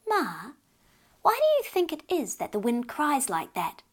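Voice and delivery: lightly voiced curious child; curious little girl. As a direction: ask it wide-eyed like a little girl. Child asks her mother.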